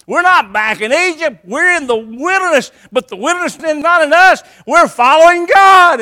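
loud, high, driving sermon voice; fiery preaching. Acted. Pastor preaching to his church at full force. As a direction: preach it hard and rising.

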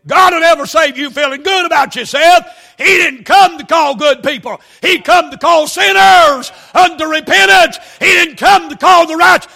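loud, high shouted preaching; full-force preaching. Acted. Preacher shouting hell at the church. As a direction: thunder it at the congregation.

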